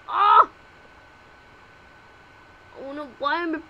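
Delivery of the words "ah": high, loud scream at a shove; shrill scream. Pretend. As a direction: scream as you are shoved. Being pushed.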